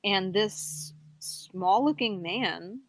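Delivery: normal talking voice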